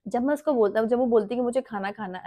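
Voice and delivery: normal talking voice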